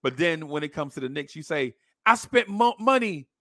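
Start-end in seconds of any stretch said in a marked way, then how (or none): 2.0-3.3 mock outraged complaint, pitched up; higher-pitched mock indignation; indignant customer. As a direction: imitate an irate paying customer. Same customer complaint, voiced again.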